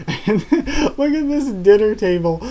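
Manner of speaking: laughing aloud. Real, not acted. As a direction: crack up at the picture. Laughing at a panel.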